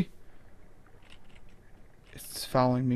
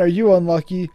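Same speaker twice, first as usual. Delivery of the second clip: usual speaking voice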